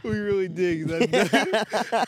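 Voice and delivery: very slow, high, stretched-out delivery; drawn-out joke. Acted. Joking with his friend, very slow and high. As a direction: stretch every word for a laugh. Joking slowed exaggerated line.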